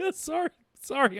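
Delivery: helpless laughter breaking into an apology; real uncontrollable laughing fit; cracking up. Real, not acted. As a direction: apologize through giggles, high-pitched. Cracking up while reading article aloud.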